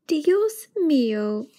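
slow, high, drawn-out mock groan; a hint of a weary mother. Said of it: "his mother groaned".

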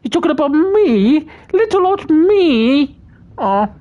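put-on high, falsetto little-old-me voice; mock coy. Streamer fishing for praise from chat, in a high, coy falsetto. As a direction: play it bashful and dainty, slowing on little old me.

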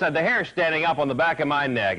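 usual speaking voice

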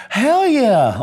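loud, high burst of agreement; excited yell. Real, not acted. Shouting yes at the interviewer, excited. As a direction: shout it out with full conviction. Answering about a higher power.

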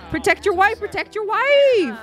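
high-pitched, excited plea; urgent urging. Real, not acted. Player urging character to defend his wife, high urgent plea. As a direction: egg him on with rising urgency.

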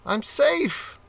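a squeak of relief; relieved rat. Acted. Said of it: "thought the rat".

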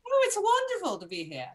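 swooping from very high in mock British; effusive put-on British accent; adopted British accent. Guest delighted to be here, mock British accent. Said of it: "We're all adopting your accent".

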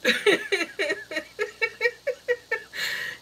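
long, high giggle fit; real helpless laughter running on; uncontrollable laughing. Real, not acted. Laughing at own mental pictures, long helpless giggle. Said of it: "start laughing and then you start thinking".

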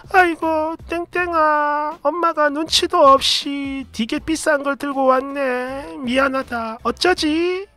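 high, slow, soft sorry-mom voice; flustered, apologetic mother. Retold or imitated. Mother apologizing to daughter over the pricey shoes, high and flustered. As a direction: fret gently, embarrassed and sorry. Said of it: "어쩔 줄을 몰라 하시는 거예요".

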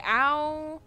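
loud, high yelp; loud startled ow; sharp ow. Real, not acted. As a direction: yelp as the character gets hit. Hurt in game.

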